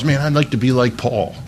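half-hearted mock of a churchgoer wanting glory without pain; a hint of a whiny believer; mock wishful believer. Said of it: "we say to ourselves".

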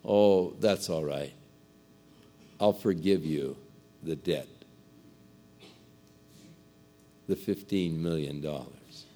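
soft and low, kindly letting the debt go; gentle forgiving lord. Acted. The lord quietly forgiving the servant's huge debt, soft and gentle. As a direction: hush it, mercy in every word. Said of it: "the Lord said unto him".

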